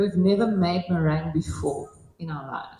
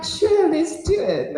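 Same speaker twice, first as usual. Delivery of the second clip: higher, eager yes to the prank; eager agreement; 12 semitones higher, 3 dB louder, slower than usual. Retold or imitated. Host suggests a prank, speaker eagerly agrees. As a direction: jump at the idea. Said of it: "And I'm like".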